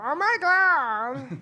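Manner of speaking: put-on slow, drawn-out Valley girl. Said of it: "L A Valley girl".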